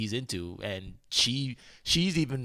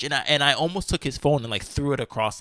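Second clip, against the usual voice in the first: ordinary delivery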